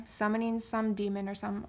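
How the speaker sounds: ordinary talk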